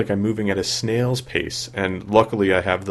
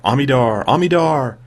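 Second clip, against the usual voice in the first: rising, louder each time; building excited call; 6 semitones higher, 6 dB louder than usual. Shown as a demonstration. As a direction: announce the name with growing gusto. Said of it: "It is a fun word to say".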